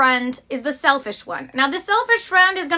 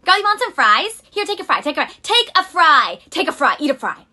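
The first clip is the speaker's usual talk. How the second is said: loud, higher insistent coaxing; pushy offering; 3 semitones higher, 6 dB louder than usual. Retold or imitated. Friend insisting she take a fry, loud and pushy. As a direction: push the fry on her again and again.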